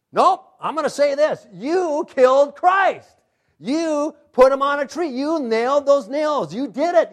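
loud, high, bold denunciation; Peter's defiant accusation. Acted. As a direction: hammer each "You" as a forceful accusation. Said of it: "Peter again is the spokesperson".

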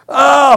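loud, high startled "ah"; mock scream. Storyteller reliving mice bursting out, mock scream. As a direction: cry out in mock fright. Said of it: "I'm just, I'm screaming".

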